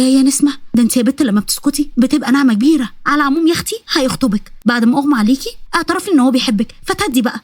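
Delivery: plain reading voice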